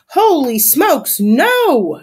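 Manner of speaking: high, loud, drawn-out exclamation; horrified shout. A narrator's reading. Landlady Crisp watches disaster coming, horrified shout. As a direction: stretch it into a dismayed yell. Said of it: "shouts Landlady Crisp".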